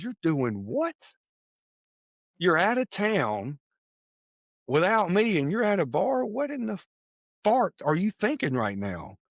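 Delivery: high, indignant scolding wife; wife scolding her husband for being in a bar, high and indignant; angry wife. Retold or imitated. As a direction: play the wife's outrage, high and sharp.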